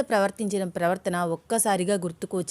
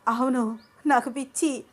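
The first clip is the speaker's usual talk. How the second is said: higher, frantic obsessive voice; unhinged demand; 5 semitones higher than usual. Acted. Shweta demanding Abhi leave Anu, frantic and higher. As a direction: beg and demand like she's lost it.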